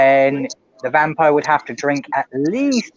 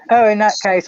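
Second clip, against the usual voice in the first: ordinary talk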